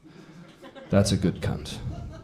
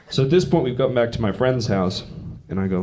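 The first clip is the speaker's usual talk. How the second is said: ordinary delivery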